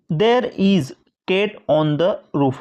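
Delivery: very slow dictation; slow example reading. Shown as a demonstration. Teacher dictating the example sentence slowly. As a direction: read it out word by word. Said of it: "मतलब कहीं पर".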